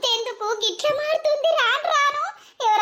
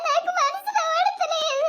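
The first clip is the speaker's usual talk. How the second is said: tearful; 7 semitones higher, slower than usual. Acted. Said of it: "ఏడుస్తున్నావు?".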